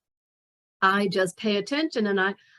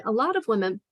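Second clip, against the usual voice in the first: ordinary delivery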